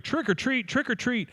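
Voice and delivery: put-on high kids' chant; trick-or-treaters. Children calling from outside her house, high sing-song. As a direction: sing it out like kids outside the door. Kids heard outside the door.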